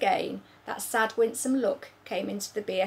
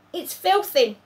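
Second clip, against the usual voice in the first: louder, higher spluttered disgust; spluttering; 9 semitones higher, 10 dB louder than usual. Acted. BFG chewing Snoscumber, louder splutter. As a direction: spit the word out. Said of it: "he spluttered".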